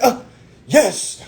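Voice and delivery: high, punchy, confident demand; re-enacted chesty self-assurance; said with his chest. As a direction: state it boldly like a boss. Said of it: "I said that shit with my chest".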